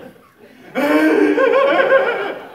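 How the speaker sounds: loud, high ugly-cry wail; hammed-up nasty bawling; mock bawling. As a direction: imitate an uncontrolled ugly cry at full volume. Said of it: "I start bawling".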